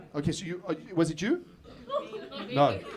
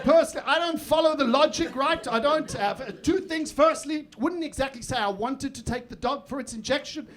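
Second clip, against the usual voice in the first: indignant retort; 7 semitones higher, 4 dB louder than usual. Retold or imitated. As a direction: argue the logic in mock outrage, pitched up. His indignant retort to partner.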